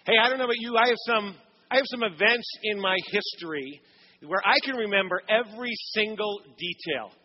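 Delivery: normal talking voice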